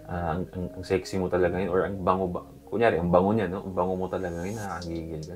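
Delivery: soft, low seductive murmur; hushed flirting, fading very soft; seductive whisper demo. Shown as a demonstration. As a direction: whisper it like into her ear. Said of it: "For example".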